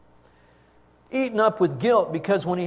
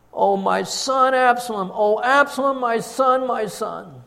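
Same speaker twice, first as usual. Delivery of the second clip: loud, higher anguished cry over his son; 7 semitones higher, 6 dB louder than usual. A narrator's reading. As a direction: wail the name aloud, rising to a peak. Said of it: "cried out with a loud voice".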